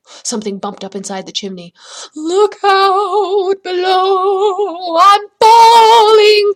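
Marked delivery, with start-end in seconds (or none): 2.1-6.5 long, high, wailing warning call; falling voice calling from high above; crying out. A narrator's reading. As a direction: holler it loud, stretching each word. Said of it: "cried a voice".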